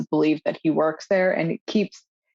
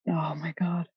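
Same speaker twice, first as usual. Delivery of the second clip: breathless whispered "oh my God"; real whispered aside; 7 dB quieter than usual. Real, not acted. Reacting to the show's scary scene, real whispered shock. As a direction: barely voice it, stunned.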